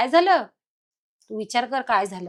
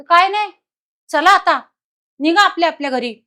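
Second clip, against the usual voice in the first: loud, higher, brusque dismissal; snapping shout; 6 semitones higher, 7 dB louder than usual. Retold or imitated. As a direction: bark at them to go home. Possessed sister-in-law dismissing family.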